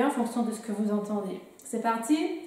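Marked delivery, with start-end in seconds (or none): none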